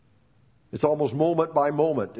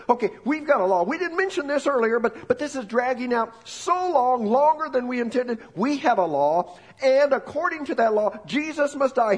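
higher, pressing voice of the leaders; insistent leaders; 8 semitones higher than usual. Acted. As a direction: push the demand like impatient accusers.

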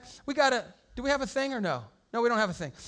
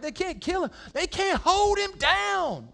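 excited disciples; 9 semitones higher, 3 dB louder, slower than usual. Retold or imitated. Disciples celebrating the resurrection.

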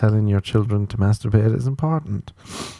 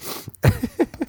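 high giggle; laugh. Real, not acted. Comedian cracking up at his own bit, high giggle. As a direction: giggle at your own joke.

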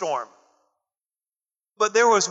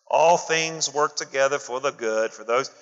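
lower, a put-on churchy voice quoting the verse; hint of a smug comforter reading scripture; mocking pious comforter; 6 semitones lower than usual. Pious friend quoting a verse to a hurting person, mildly mocking. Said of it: "when they read that to you".

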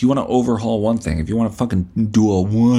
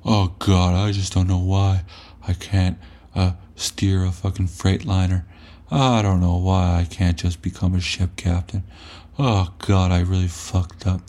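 lightly voiced self-pitying whine, lower; mock whining; 4 semitones lower than usual. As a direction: mimic a hopeless moaner. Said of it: "bitching and whining".

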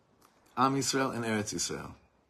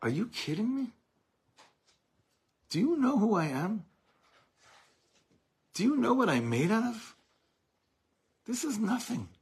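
much higher, incredulous scoff; defiant scoffing retort; 11 semitones higher than usual. Retold or imitated. Preacher voicing the people's answer to mockers, higher and scornful. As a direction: toss it back at them, unshaken and scornful. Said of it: "we'd laugh in their face and say".

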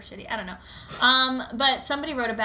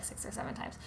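ordinary delivery

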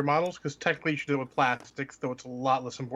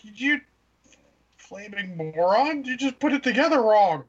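higher, louder, slow exasperated insult; ranting at a customer; mock exasperated; 7 semitones higher, 5 dB louder, slower than usual. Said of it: "I'm like".

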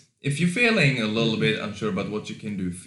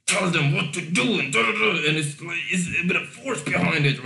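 mock forceful bossing, high and pushed; hammed-up pushy ordering; controlling, forceful; 8 semitones higher, 4 dB louder than usual. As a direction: imitate someone trying too hard to control. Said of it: "A guy who's trying to be controlling".

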